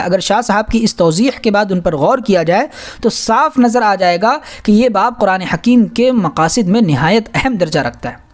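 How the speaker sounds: usual speaking voice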